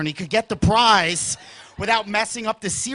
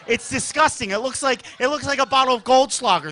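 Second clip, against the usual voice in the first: ordinary talk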